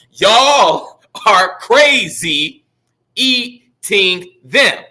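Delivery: slow, evenly spaced syllables; poem recitation. Shown as a demonstration. Teacher going over his haiku again, slow syllable-by-syllable recitation. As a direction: count out the syllables as you recite.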